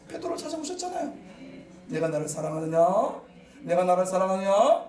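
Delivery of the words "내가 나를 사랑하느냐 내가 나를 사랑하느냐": a lightly voiced Jesus asking, growing louder each time; Jesus asking, building. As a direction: ask it three times, pressing harder. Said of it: "세 번씩 물어보셨잖아요". (점점 커지는 예수님의 물음)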